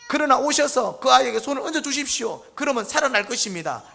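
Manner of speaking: high, forceful appeal; loud plea aloud. Acted. Synagogue leader begging Jesus to come heal his daughter, loud plea. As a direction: call it out so all around hear. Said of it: "크게 했다 이 말이죠".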